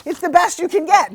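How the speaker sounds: thrilled, high-pitched exclamation; excited. Real, not acted. Delighted echo of good news.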